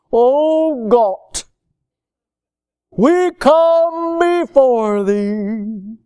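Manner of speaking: very slow, stretched-out mock prayer. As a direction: intone it pompously, dragging every word. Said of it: "they'll stretch their voice out".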